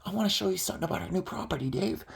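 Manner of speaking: quick, hushed imitated patter; Don. Don showing Dave something on screen, quick soft patter. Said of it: "selecting them all and going".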